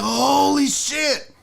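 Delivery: slow, awestruck exclamation; stunned awe. Acted. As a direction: stretch it out in mock astonishment. Awed reaction to sighting.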